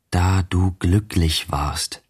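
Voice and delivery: very slow, drawn-out exclamation; anguished exclamation. A narrator's reading. Narrator exclaims to himself walking back to town, slowly crying out. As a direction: cry it out slowly, word by word. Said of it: "rief ich aus".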